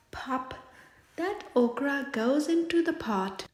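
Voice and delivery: usual speaking voice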